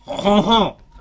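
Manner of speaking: loud, high French "hoh-hoh"; mock French chortle; French-style laugh. Game master launching a French character, French-accented laugh. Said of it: "imagine he has a French accent".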